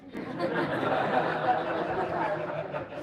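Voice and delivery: long breathy burst of laughter. Real, not acted. Preacher laughs out loud at his own joke. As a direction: laugh loudly at the punchline.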